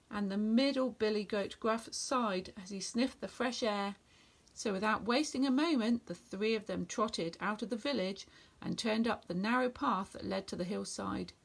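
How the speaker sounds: plain reading voice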